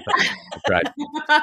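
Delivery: laughing through the words; high giggling delivery. Real, not acted. Laughing at own joke.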